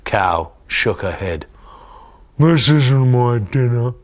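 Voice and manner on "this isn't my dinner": slow, loud indignant huff; huffy. Acted. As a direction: huff it out, put out and stubborn. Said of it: "she huffed".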